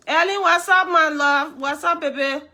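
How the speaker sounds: usual speaking voice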